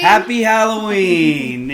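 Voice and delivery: slow, high eerie greeting; spooky voice. Pretend. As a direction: drag it out like a haunting ghost. Halloween greeting in costume.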